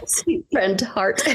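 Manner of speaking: giggling through the words, slow; laughing at her own slip. Real, not acted. Laughing at her own tongue slip, giggling. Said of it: "I almost said sweet fart".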